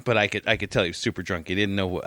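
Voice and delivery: normal talking voice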